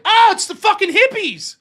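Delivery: high, loud mock exclamation; mocking outcry. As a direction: crow it out on spotting your targets. Acting out spotting the hippies again.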